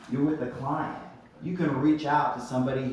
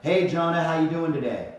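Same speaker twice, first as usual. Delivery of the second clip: higher, louder, assured phone greeting; louder, more confident; 3 semitones higher, 4 dB louder than usual. Retold or imitated. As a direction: boss walking onto the call. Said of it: "speaking a little bit louder, a little more confidently".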